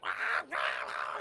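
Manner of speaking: drawn-out monster cries; mock creature screeching; creature wail. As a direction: imitate a monster freaking out in pain. Imitates creature freaking out.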